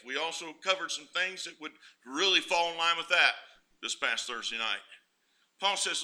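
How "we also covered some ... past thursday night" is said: ordinary talk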